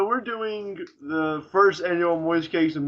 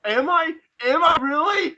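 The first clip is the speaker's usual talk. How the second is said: mock offended; 12 semitones higher than usual. Mock offended reply to teasing.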